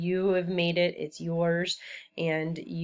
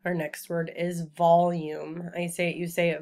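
ordinary delivery

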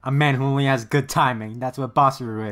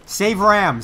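urgent plea; 6 semitones higher, 7 dB louder than usual. Real, not acted. Urging a character.

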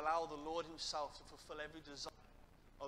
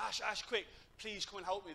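higher, urgent call for help; retold urgent plea, pitched up; 5 semitones higher, 5 dB louder than usual. Girl runs over to fetch a leader, urgent.

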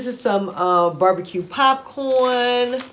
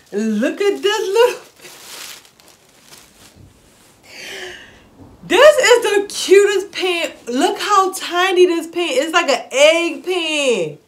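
excited gushing; 7 semitones higher, 3 dB louder than usual. Real, not acted. Gushing over tiny egg pan.

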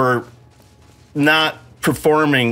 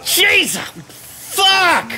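frustrated yell; 12 semitones higher than usual. Real, not acted. Boss double attack again.

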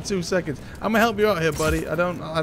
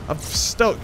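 normal talking voice

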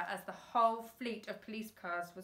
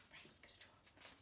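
a hushed unvoiced aside; whisper; 24 dB quieter than usual. A narrator's reading. Narrator whispering a secret aside to the listeners. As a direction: breathe it as a secret.

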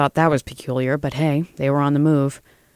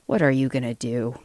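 growled threat, kept contained; 2 semitones lower, 3 dB quieter than usual. A narrator's reading. Lobbyist challenges Joel after being pushed, growling. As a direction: growl it like a bulldog. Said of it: "growled the bulldog".

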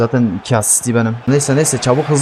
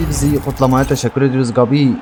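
usual speaking voice

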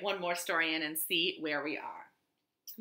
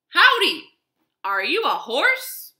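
high, loud friendly howdy; cheerful cowboy greeting; 7 semitones higher, 9 dB louder than usual. Acted. Cowboy greeting a wagon, high and loud. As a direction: call out like an eager young cowboy. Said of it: "Cowboy asked".

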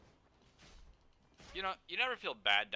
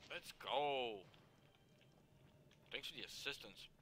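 normal talking voice

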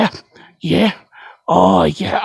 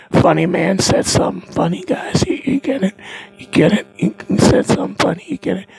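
ordinary talk, nothing marked

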